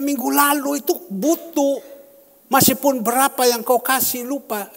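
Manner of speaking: high, loud reproach of a forgetful member; loud scolding. Retold or imitated. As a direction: scold him for skipping his gift. Pastor scolds congregant for forgetting.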